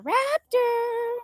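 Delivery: high, loud, drawn-out name call. Real, not acted. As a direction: holler for a hidden pet. Calling a hen.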